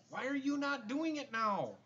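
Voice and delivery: high, loud, playful coaxing; excited high coaxing. Real, not acted. Coaxing baby to dance.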